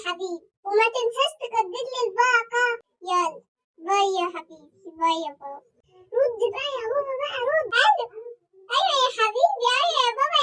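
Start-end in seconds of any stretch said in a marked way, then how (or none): none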